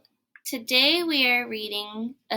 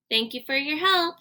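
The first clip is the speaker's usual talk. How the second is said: ordinary delivery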